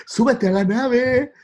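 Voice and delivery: high, slow call from far away; distant space shout. Retold or imitated. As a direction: yell across outer space. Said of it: "como si fueras Buzz Aldrin gritándole".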